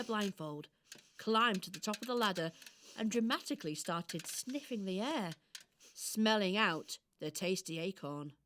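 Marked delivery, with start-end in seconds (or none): none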